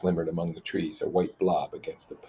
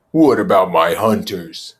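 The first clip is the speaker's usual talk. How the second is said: louder, hard challenge; Jack's angry demand; 9 dB louder than usual. Acted. Jack repeating his demand to Ralph, louder. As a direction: push it at him.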